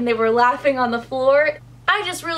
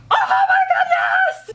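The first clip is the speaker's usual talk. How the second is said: much higher, louder, laughing shout; excited squeal; 4 dB louder than usual. Real, not acted. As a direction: burst out in real delight. Fangirl joy over them being mates.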